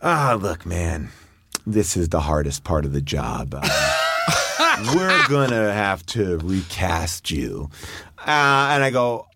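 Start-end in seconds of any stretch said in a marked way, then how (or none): 0.0-7.7 deep, slow, regretful producer voice; low somber producer. Retold or imitated. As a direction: drop low and drag it out like a sorry boss. Producer firing him, voiced low.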